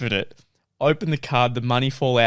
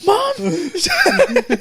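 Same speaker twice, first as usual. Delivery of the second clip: much higher, laughing yell; mock kid shouting for mum; calling mum. Six-year-old calling for mum, high laughing shout.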